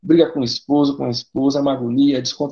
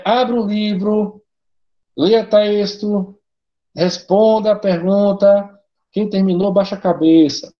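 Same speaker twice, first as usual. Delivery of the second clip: mock bored teacher; 6 semitones higher, 3 dB louder, slower than usual. Mocking a lazy teacher's orders.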